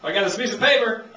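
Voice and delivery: ordinary delivery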